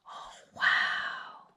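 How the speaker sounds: soft whispered wow; awed whisper. Real, not acted. Reader marvelling at the picture, whispered. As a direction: breathe it in wonder.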